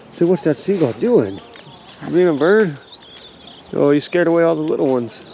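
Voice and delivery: loud, high chatter at the dog; talking to a dog. Real, not acted. Talking to dog about birds.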